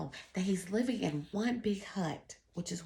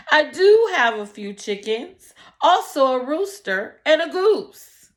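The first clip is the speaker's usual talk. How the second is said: loud, higher, hopeful answer; eager poor man; 8 semitones higher, 13 dB louder than usual. Acted. Poor man tells the rabbi about his animals, loud and eager. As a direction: blurt it out, eager to please.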